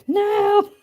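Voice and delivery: put-on high, slow kids' protest; whiny kid voice. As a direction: drag it out like an indignant child. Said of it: "they're like".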